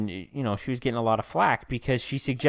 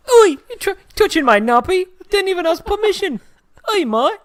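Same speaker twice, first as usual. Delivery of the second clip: very high, loud mock British baby; an outraged British baby, pitched way up; baby in British accent; 7 dB louder than usual. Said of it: "the baby's like".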